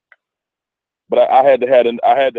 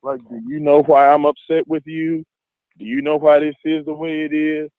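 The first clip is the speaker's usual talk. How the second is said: put-on higher, patient voice explaining to a kid; imitating a parent talking down to a child; 6 semitones higher than usual. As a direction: ask it gently, as if to a small child. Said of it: "talk to him like a child".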